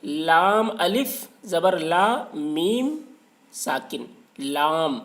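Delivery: لام الف زبر لا میم spelled letter by letter, slowly; slow spelling. Shown as a demonstration. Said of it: "بنا ہے تین حروف سے مل کر".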